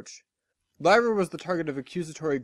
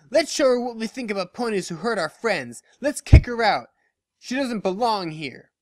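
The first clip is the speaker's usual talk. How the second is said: higher, louder call to the crowd; rallying the mob; 6 semitones higher, 5 dB louder than usual. Acted. Dash rallying the crowd to kick Lyra out, loudly. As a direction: whip them up.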